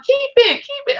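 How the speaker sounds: put-on high-pitched quote of a girlfriend's plea; imitated eager girlfriend. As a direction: mimic her pleading in a high voice. Acted quote of animal-loving girlfriend.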